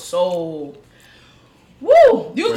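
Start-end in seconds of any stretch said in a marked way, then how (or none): 1.8-2.3 high shouted woo; loud woo. Real, not acted. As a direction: let out a big woo. Exclaiming about the news.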